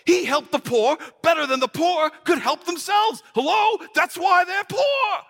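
high-pitched incredulous rant; exasperated, high-strung objection; indignant outburst. Acted. Indignant rant about the poor.

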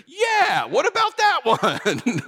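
playful mock offence; mock outrage. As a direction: ham up being shocked at the hidden verse. Mock indignant about hidden verse.

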